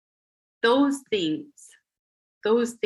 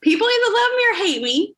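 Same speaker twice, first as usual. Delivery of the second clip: high, fast and louder; excited; 12 semitones higher, 5 dB louder, faster than usual. Real, not acted. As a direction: burst out with glee.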